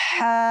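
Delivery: হা pressed out from mid-throat. Shown as a demonstration. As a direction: squeeze a strong breathy h from the middle of the throat on হা. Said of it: "এটা চাপ দিয়ে পারবো". (হা কণ্ঠনালীর মধ্যাংশ থেকে চাপ দিয়ে)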